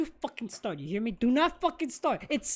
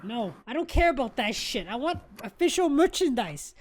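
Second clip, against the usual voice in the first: usual speaking voice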